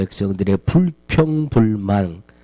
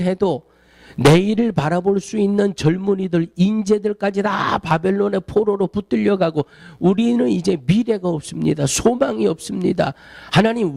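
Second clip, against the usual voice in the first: higher, pleading wail to God; anguished outcry; 5 semitones higher than usual. Retold or imitated. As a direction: cry out to God in despair. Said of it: "현실만을 보고 아우성치는 거예요". (절망한 백성이 하나님께 높게 부르짖듯)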